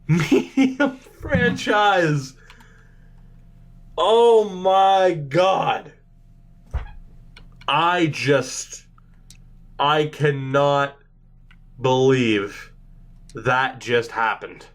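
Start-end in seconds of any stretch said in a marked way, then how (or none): 4.0-14.7 low, halting disbelief; stunned. Real, not acted. Streamer who cannot believe the pick, low and stunned. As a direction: spell it out in small dazed pieces. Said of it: "I am stunned".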